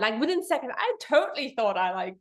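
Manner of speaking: ordinary talk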